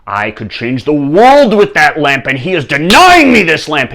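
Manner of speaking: loud, high, ranting fury; enraged king. Acted. King denied the lamp by a monk, raging. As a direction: rant at full volume, outraged. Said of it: "the king flew into a rage".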